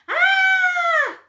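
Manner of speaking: high, loud mock scream; playful scream. Leader screams for the crocodile in the song, playful shriek. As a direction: scream like you saw a crocodile. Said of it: "don't forget to scream".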